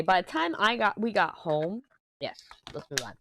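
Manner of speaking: normal talking voice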